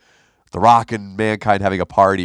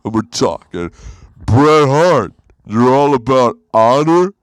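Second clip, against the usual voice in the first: slow, louder wrestler promo voice; Scott Hall impression; 3 semitones higher, 3 dB louder than usual. Comic doing a wrestler promo at an open mic, slow and louder. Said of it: "I did a Scott Hall impression".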